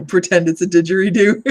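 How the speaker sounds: laughing loudly through the words. Real, not acted. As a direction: giggle it out loud. Laughing at the jackhammer noise.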